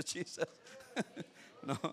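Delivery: usual speaking voice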